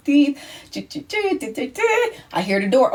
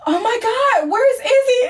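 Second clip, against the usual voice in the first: high gasp then a worried question; alarmed gasp; 12 semitones higher than usual. Retold or imitated. Roommate suddenly worried about Izzy, high gasp and question. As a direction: startle then ask urgently. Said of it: "I was like".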